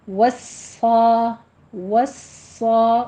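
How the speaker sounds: وصا stretched very slowly, heavy صاد; drawn-out وصا. Shown as a demonstration. Teacher stretches the heavy صاد for students. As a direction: hold وصا long and full. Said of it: "صاد پر پڑھا جائے گا".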